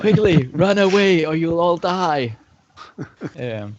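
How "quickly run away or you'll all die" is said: loud, high, slow-paced shout; high, booming cry of threat; shouted warning. Acted. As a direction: shout a drawn-out warning to the guards. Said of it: "I shout out".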